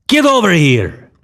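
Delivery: high holler falling at the end. A narrator's reading. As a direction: holler an order at the kid.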